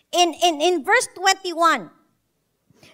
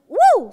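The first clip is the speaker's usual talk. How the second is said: excited woo; 6 semitones higher, 7 dB louder than usual. Retold or imitated. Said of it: "sabi niya".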